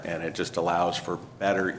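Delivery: ordinary talk, nothing marked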